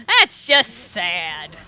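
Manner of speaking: drawn out and very slow. Shown as a demonstration. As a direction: stretch every word out slowly. Repeating the remark slowly.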